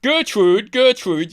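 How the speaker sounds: loud, high calling out Gertrude; posh shout to wife. Retold or imitated. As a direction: call across the manor to your wife. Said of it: "he's shouting to his wife".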